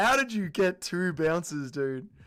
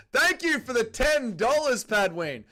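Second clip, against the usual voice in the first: slow, high, louder thanks; energetic shout-out; excited thanks; 8 semitones higher, 6 dB louder, slower than usual. Real, not acted. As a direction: call out thanks to the donor. Donation thanks from streamer.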